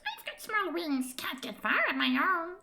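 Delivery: high, slow squeaky sprite voice; a little fairy shrugging off danger; tiny creature. Acted. Creature explains its weakness.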